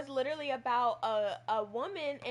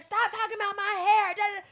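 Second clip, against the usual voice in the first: higher, louder mock outburst; aggressive demand; 8 semitones higher, 8 dB louder than usual. The woman as she could have snapped, louder and higher. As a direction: fake an angry confrontation. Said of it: "aggressive and be like".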